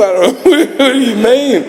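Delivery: high, laughing bewilderment; baffled laughing. Retold or imitated. Struggling man answering his sponsor, baffled and laughing. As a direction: blurt it through a laugh, thrown.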